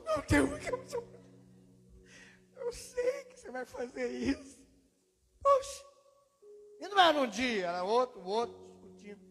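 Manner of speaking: loud, high, breaking voice crying out to God. Real, not acted. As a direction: cry out as the tears come through. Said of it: "Voltava chorando". (clamando alto, com a voz embargada de choro)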